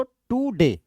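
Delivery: "टू डे" split in two, long टू; wrongly stressed TOO. Shown as a demonstration. Teacher reminds students of the wrong reading, drawn-out टू in "टू डे". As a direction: give टू in "टू डे" its own weight. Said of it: "ऐसे तो पढ़ते नहीं".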